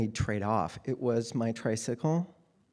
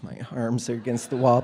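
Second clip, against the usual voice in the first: ordinary talk, nothing marked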